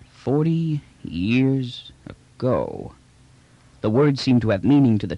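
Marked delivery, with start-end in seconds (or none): 0.1-2.9 drawn out slowly and thoughtfully; slow, musing echo. Acted. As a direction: let each word hang, lost in thought. Trader echoes, musing.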